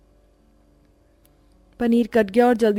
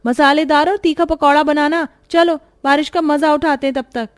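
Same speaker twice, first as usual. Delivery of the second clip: high, mocking, dismissive; sarcastic nanad; 9 semitones higher than usual. Nanad mocking bhabhi's sickness, high and sarcastic. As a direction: scoff at her excuse.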